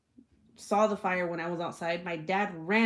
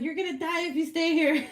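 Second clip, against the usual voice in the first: high-pitched drama-queen wail; dramatic warning; 8 semitones higher than usual. Retold or imitated. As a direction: warn of doom, hammed-up. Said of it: "so, so dramatic".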